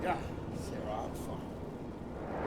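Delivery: slow, soft, breathless reply; barely able to speak after crash; weak, hurt. Acted.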